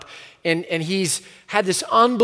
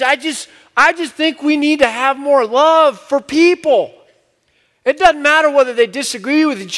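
higher and louder, a resonant preaching cadence; imitating John Perkins; 8 semitones higher, 5 dB louder than usual. Pastor retelling a civil rights elder's plea to leaders, higher, louder impression. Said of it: "and he just says".

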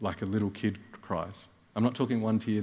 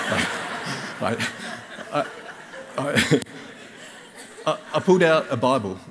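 louder, higher, laughing through the words; 4 semitones higher, 6 dB louder than usual. Real, not acted. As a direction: giggle through it as the joke lands. Laughing at his own joke.

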